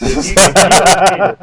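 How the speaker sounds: laughing. Real, not acted. Laughing through a threat.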